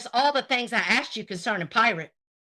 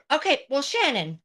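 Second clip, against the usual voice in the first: ordinary talk, nothing marked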